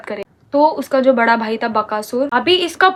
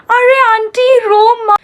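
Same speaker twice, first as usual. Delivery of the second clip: high, slow, louder sarcastic mimic; mock fake comfort; 9 semitones higher, 4 dB louder, much slower than usual. Mocking the hypocrite comforting her crying mom. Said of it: "मेरी mummy के पास जाते बोलते".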